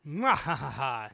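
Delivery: slow, loud hammed-up evil cackle; booming mock evil laugh; villain laugh. Eva's villain laugh from manga.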